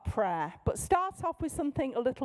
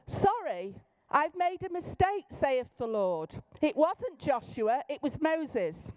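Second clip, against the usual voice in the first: raised, mock-solemn proclamation; King James prophet; 4 semitones higher than usual. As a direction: correct yourself while keeping the pompous prophecy voice. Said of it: "this is the King James style person".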